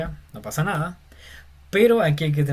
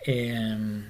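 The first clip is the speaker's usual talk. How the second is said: normal talking voice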